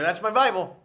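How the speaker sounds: indignant quote with a punch; retold insistent protest. Retold protest to the prison guard.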